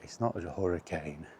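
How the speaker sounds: ordinary talk, nothing marked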